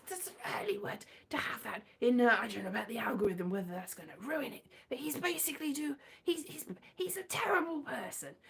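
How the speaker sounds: unvoiced aside whisper; secretive spoiler whisper. Real, not acted. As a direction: whisper it so the algorithm won't hear. Worried about spoilers and algorithm.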